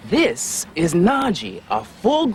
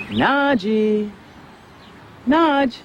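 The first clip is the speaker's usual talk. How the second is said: calling a lost pet; 7 semitones higher, slower than usual. Pretend. Host calls hiding tiger like pet.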